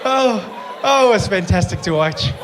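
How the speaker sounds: words through real laughter; laughing. Real, not acted. Comedian laughing as he tells of the man falling. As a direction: laugh while telling it.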